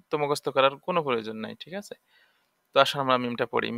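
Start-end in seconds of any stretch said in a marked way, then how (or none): none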